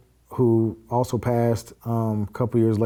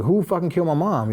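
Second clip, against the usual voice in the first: higher-pitched, mildly heated disbelief; retold shocked demand; 6 semitones higher than usual. Nephew demanding answers from the caller about his mom, retold outrage. Reacting to news of mother's killing.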